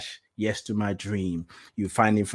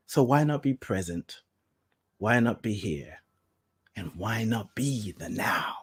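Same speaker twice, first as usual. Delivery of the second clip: fading softer; 3 semitones lower, 8 dB quieter than usual. Real, not acted. Rhetorical questions fading down.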